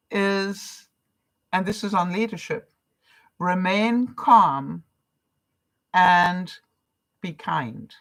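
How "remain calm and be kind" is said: slow, deliberate reading of the rule; calm, measured mantra read. Shown as a demonstration. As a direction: lay each word down slowly. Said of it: "my new mantra is remain calm".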